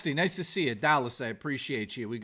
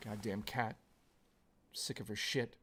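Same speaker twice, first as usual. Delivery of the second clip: muttered grumble; 5 semitones lower, 11 dB quieter than usual. Real, not acted. Grumbling aside.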